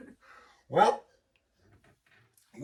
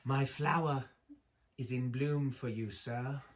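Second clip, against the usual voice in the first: imagined British voice; 5 dB quieter than usual. Acted. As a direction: recite it gently in a put-on British accent. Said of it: "like a British kind of a, a British voice".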